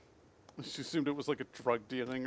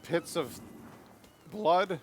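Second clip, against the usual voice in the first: high, raised voice, word by word; halting angry shout; 5 semitones higher, much slower than usual. Pretend. Player cursing the village's blood pits mid-attack, halting angry shout. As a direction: spit out the last curse in pieces.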